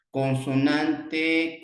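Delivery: drawn out, slow: con-so-nan-te; teacher spelling out "Consonante" slowly; slow syllable demo. Shown as a demonstration. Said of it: "consonante vocal".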